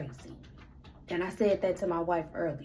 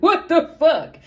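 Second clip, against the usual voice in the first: much higher, much louder, stunned yell; shocked outburst; 9 semitones higher, 13 dB louder than usual. Retold or imitated. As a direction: blurt it out in disbelief. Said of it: "I'm just like".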